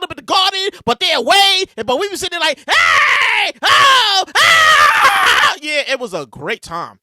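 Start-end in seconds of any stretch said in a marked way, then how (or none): 2.6-5.5 loud whooping cheers; excited sports-fan yells; crowd cheering. Retold or imitated. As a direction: shout like the crowd at the game. Cheering at watch party.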